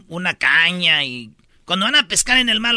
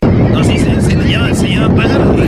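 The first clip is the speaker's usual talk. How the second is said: gossip whisper; 8 dB louder than usual. Pretend. Joins the mock gossip whisper.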